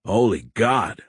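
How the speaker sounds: ordinary delivery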